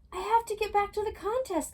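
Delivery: high, loud, urgent cry; cried out. A narrator's reading. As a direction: plead to get back, high and loud. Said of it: "Lizzie cried".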